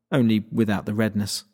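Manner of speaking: ordinary delivery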